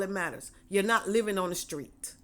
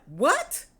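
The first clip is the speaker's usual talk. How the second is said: sharp, high, loud mock what; a stunned, disbelieving yelp of a question; shocked what; 7 semitones higher, 6 dB louder than usual. Caller hears the mortgage is paid, yelps in surprise. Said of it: "And you like".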